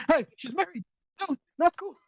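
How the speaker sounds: high-pitched scolding, not shouted; scandalized bystander, pitched high; indignant protest. Acted. Onlooker scolds a flirting man.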